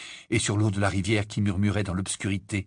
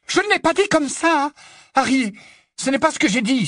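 very high, loud desperate protest; crying out; 6 dB louder than usual. Acted. Hermione protests to Harry against Ron's claim, crying out. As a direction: wail the denial at top pitch. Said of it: "s'écria-t-elle".

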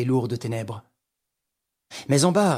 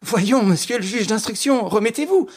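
high, insistent exhortation; raised-pitch, pressing appeal; urging composure; 9 semitones higher, 3 dB louder than usual. Acted. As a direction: urge a shaken man to pull himself together. Urging terrified magistrate to pull together.

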